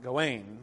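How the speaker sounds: Gawain as guh-WAYN; last-syllable stress. Shown as a demonstration. Lecturer showing the pronunciation that doesn't fit here, last-syllable stress. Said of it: "because the emphasis, the alliteration".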